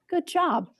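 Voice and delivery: ordinary talk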